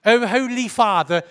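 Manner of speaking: mock a pastor praying in British English; British prayer voice. Mocking how pastors pray, in British accent. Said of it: "They speak with a British accent".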